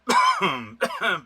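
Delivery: harsh coughing fit from water; sudden loud choking cough. Real, not acted. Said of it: "tô até engasgando com a água".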